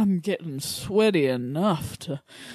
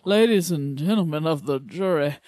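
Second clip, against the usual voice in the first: honeyed southern lawyer drawl. Acted. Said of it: "Turned us into, like, Southern lawyers".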